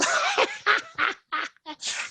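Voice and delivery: high teasing cackle; mocking laugh. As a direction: laugh in his face. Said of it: "So I laughed at him".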